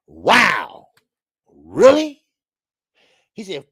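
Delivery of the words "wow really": mock shock. Streamer reacting to a boxer's insult in loud mock disbelief. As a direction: gasp it out in fake shock.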